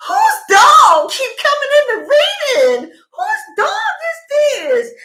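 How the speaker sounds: loud, high exasperated call-out; shouting at dog. Real, not acted. Reader interrupted by the dog, yelling in annoyance. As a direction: yell out at the dog wandering in.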